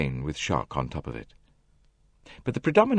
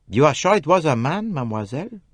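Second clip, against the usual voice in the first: Poirot asking, higher and pointed; a sudden shift in tone, probing; different tone; 9 semitones higher, 4 dB louder than usual. A narrator's reading. Poirot questioning Jackie, in a changed probing tone. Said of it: "in quite a different tone of voice".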